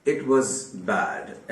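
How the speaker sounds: each word laid out slowly for learners; slow teacher's example sentence; slow dictation. Shown as a demonstration. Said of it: "ऐसे कहा आपने".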